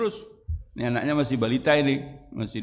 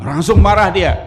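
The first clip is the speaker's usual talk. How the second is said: loud emphatic; 6 semitones higher, 9 dB louder than usual. Real, not acted. Preacher stresses anger.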